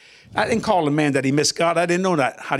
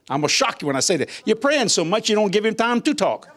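ordinary talk, nothing marked

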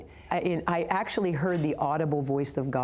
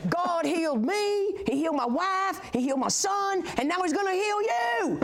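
fervent healing preacher; 13 semitones higher than usual. Retold or imitated. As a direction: imitate a preacher building through each phrase. Retells fervent stranger declaring healing.

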